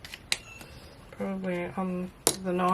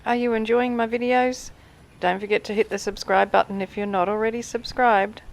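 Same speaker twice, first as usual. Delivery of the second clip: upbeat plea; 3 semitones higher, 9 dB louder than usual. Real, not acted. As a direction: sell the subscribe ask. Viewer plea to subscribe.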